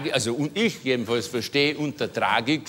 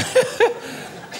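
high squeal of laughter. Real, not acted. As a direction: laugh breaking out high and airy. Laughs mid-sentence. (hohes, quietschendes Auflachen)

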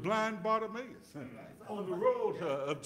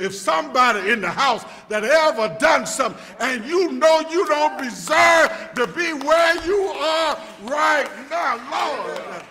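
louder, rising preacher's call toward a shout; full-force preaching; 7 semitones higher, 12 dB louder than usual. Acted. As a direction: build it up like a Sunday climax.

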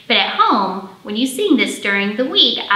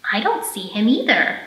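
lively picture-book storytelling for little ones; reading to children; slower than usual. Acted. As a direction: read it like story time at the library. Librarian reads picture book aloud.

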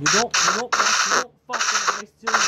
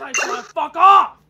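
loud, frustrated yell, higher and louder; angry shout; 7 semitones higher, 8 dB louder, slower than usual. Real, not acted. Yelling at the game in frustration, angry shout. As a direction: blow up at the screen.

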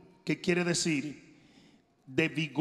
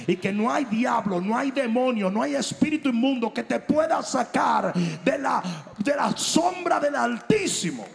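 shouted, rising preacher's cry over music; fiery pulpit shout; preaching at full force; 5 semitones higher, 6 dB louder than usual. Acted. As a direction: drive it home like a revival preacher.

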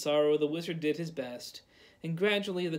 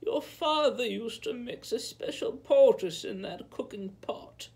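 put-on old peasant woman; 7 semitones higher, 3 dB louder than usual. A narrator's reading. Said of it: "she told him".

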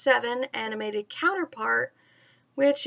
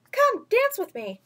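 crying out; 9 semitones higher than usual. Acted. Mowgli calling Bagheera to dance, high eager cry. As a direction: call him to dance. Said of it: "he cried".

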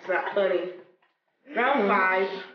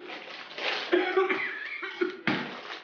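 choking cough; 11 semitones higher, 6 dB quieter than usual. Real, not acted. Chip burning throat.

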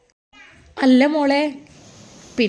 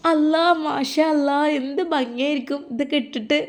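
tender awe; 4 semitones higher than usual. Acted. Grandmother admiring the children's clothes. (മൃദുവായ അത്ഭുതത്തോടെ)

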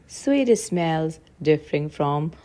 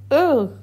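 high-pitched cry of distaste; short, high ugh; disgusted "ugh"; 8 semitones higher, 4 dB louder than usual. A narrator's reading. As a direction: recoil from a bad bite. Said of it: "cried the Duchess".

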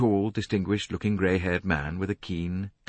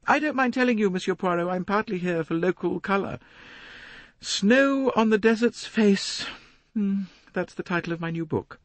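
much higher, a self-important lady complaining; a fussy society matron, preening; 14 semitones higher than usual. A narrator's reading. Mrs Otterbourne voiced by the narrator.

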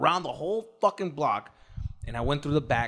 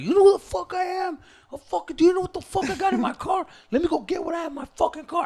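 put-on rowdy drunk. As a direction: bluff like a drunk tough guy.

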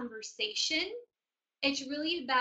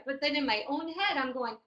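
ordinary talk

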